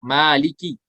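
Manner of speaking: ordinary talk, nothing marked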